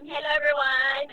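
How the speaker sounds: ordinary talk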